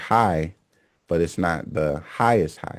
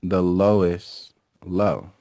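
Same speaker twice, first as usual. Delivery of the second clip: "lowest low" said slowly with weight on "lowest"; stress on lowest; slower than usual. Shown as a demonstration. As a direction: draw out "lowest" to drive it home. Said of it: "I'm emphasizing lowest low".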